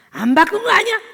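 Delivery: hammed-up accusing shout at wife; husband bursts out at wife over humidifier, loud mock yell. Husband yelling.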